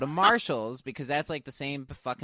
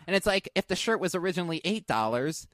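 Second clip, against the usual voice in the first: ordinary delivery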